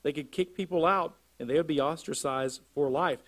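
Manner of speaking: normal talking voice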